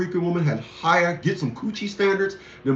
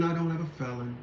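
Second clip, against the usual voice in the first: much softer, muttered under the breath; quiet aside; 4 semitones lower, 11 dB quieter than usual. Shown as a demonstration. Repeating to viewers that he has no felony, muttered softly. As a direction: drop it to a mutter, to yourself. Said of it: "repeats the line twice".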